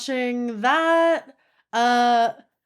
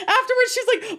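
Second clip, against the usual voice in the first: normal talking voice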